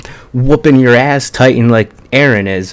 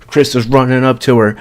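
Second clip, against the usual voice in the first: normal talking voice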